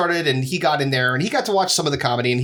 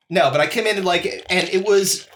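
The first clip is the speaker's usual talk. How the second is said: ordinary talk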